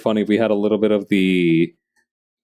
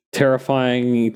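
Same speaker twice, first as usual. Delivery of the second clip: usual speaking voice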